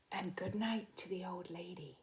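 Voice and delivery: soft unvoiced whisper; hushed bedtime whisper. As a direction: whisper it as the room falls asleep. Bedtime reading whispered line.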